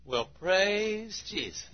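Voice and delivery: high, slow drawn-out praise; imitating church member. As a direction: stretch it out like a happy old believer. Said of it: "he'd say".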